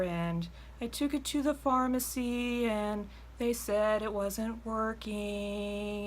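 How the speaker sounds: high, slow, drawn-out rambling caller voice. Retold or imitated. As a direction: drag it out like a caller who never gets to the point. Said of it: "they go".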